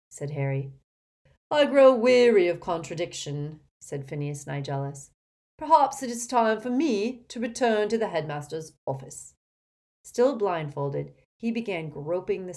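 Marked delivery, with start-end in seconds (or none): 1.5-3.6 loud, raised, pompous complaint; affronted grandee, projecting; haughty exasperation. Acted. Portrait complains of being contradicted. 5.6-9.3 high, loud, lofty announcement; haughty and projected; affronted pompous portrait. Acted.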